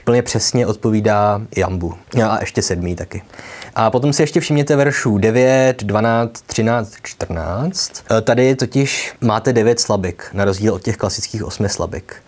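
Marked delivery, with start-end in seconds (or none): none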